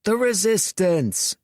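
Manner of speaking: high rallying shout. A narrator's reading. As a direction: call it back to the troops. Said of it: "shouted Dorian".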